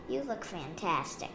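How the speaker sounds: ordinary delivery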